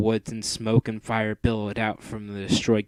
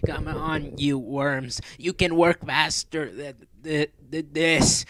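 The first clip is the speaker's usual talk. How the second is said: higher, snarling mistress voice; snarling villainess; 5 semitones higher, slower than usual. Acted. Mistress driving the robots to work faster, snarling. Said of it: "snarled the Mistress of Darkness".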